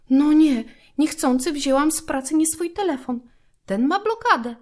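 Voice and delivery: usual speaking voice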